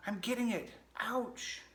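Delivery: high, pained critter voice; struggling pack rat. Acted. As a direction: strain and yelp as a pricked little rat.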